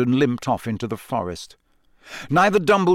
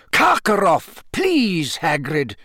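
sharply; 5 semitones higher, slower than usual. A narrator's reading. Dumbledore urging Hagrid to fetch Karkaroff, sharp. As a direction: snap him back to the errand. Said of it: "said Dumbledore sharply".

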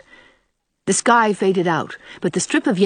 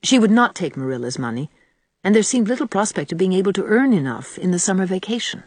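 usual speaking voice

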